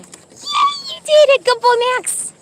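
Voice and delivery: high squealing cheer; excited dog praise. Real, not acted. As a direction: celebrate a dog's success in a sky-high voice. Praising dogs after a success.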